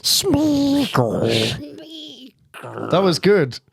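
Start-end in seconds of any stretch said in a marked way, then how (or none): 0.0-1.7 high, strained creature voice from the throat; Gollum voice. Shown as a demonstration. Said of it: "So start coming".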